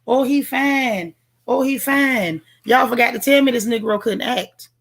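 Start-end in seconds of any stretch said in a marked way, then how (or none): 0.0-2.4 slow, dreamy mock swoon over a fine man; imitating the chat gushing. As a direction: drawl it like a lovestruck fan, a little higher. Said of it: "Y'all was too busy talking about".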